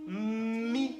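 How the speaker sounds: "Mi" with a long hummed M before I; first sound stretched. Shown as a demonstration. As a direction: hold the M, then release "Mi". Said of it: "Quel est le premier son que j'entends". (« Mi » avec M très allongé)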